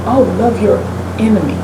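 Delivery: ordinary delivery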